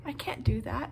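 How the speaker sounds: gently refuse, a mild hurt tone; soft sad refusal. Retold or imitated. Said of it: "And Freddie's like".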